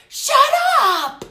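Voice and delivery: loud, high imitated yell; yelled retort. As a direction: yell it right into her face, drawn out. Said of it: "goes right into her face and yells".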